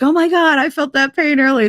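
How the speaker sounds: high-pitched imitation of a spooked ex; exaggerated worried realization; mock alarm. Acting ex believing the curse.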